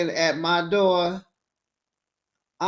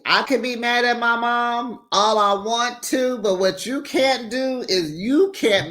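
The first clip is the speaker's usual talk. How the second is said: raised, far-off voice scolding a partner; mock scolding; 7 semitones higher than usual. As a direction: tell him off about your mom. Opening quote, raised mock scolding.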